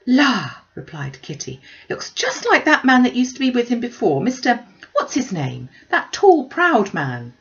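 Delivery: high, loud gushing; excited girl. Acted. Kitty gushing to mamma about the tall proud man. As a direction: squeal it out, eager and giddy.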